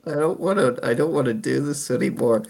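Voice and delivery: mopey, defeated whining; sad voice. Retold or imitated. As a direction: sigh it out like you've given up. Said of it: "sad voice just goes".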